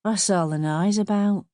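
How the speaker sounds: put-on drunken, slurred mother; boozy, loose-tongued question. A narrator's reading. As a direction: slur it as if tipsy. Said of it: "Elsie's voice was slurred".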